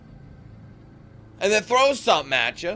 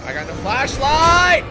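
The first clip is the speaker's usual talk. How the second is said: louder, higher, slow exasperated repeat; fed-up cry of complaint; frustrated outburst; 6 semitones higher, 6 dB louder, slower than usual. Real, not acted. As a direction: stretch it out in exasperation.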